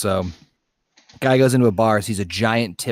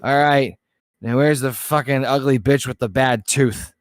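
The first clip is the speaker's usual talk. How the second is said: loud, higher-pitched mock drunk; hammered drunk; 5 semitones higher, 4 dB louder than usual. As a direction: play the hammered challenger calling out boldly. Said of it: "he's hammered".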